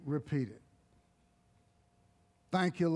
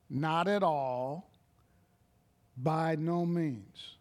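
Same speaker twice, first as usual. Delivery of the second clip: slow emphasis; slower than usual. Shown as a demonstration. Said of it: "slow emphatic definition".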